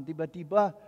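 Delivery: normal talking voice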